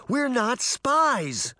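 high, drawn-out protest; stretched, protesting cry; indignant exclamation. A narrator's reading. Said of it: "Sabrina exclaimed".

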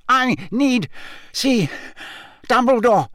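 high, halting, desperate gasps; strained effort. A narrator's reading. Crazed man seizing Harry's robes, strained and high. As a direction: force each word out between pauses. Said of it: "Every word he spoke seemed to cost him a terrible effort".

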